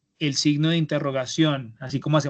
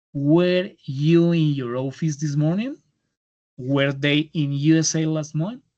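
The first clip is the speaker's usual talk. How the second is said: slow, careful reading of model questions; slow example reading; slower than usual. Shown as a demonstration. Teacher reading English example questions to students, slow and careful. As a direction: read each example slowly for the class to copy.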